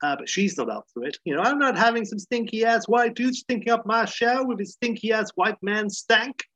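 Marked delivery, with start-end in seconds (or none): 1.4-6.4 hamming up an indignant woman; mock Wanda voice. Refusing Larry her shower, in a put-on sassy voice.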